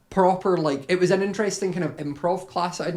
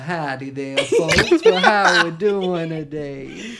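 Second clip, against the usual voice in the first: Southern drawl; 4 dB louder, much slower than usual. Shown as a demonstration. Streamer demos his cowboy roleplay voice in a slow Southern drawl. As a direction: drawl it out like a Benoit Blanc cowboy. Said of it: "Southern drawl to it".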